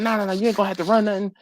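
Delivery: hurried, insistent Southern coach, retold. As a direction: play a Southern coach quickly talking a kid round. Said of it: "he was real Southern".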